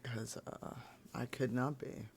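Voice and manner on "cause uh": rough, gravelly just-woke-up voice; gravelly morning rasp, like a smoker. Real, not acted. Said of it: "sorry for a gravelly morning voice".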